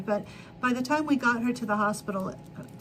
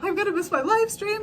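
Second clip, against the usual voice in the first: mock panic; 8 semitones higher than usual. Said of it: "I was like".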